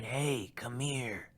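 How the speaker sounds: hushed raspy beckoning, imitated; low, raspy voice. Creature in the bush luring the friends closer, low raspy voice. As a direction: put on a soft, raspy creature's call. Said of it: "in, like, a low, raspy voice".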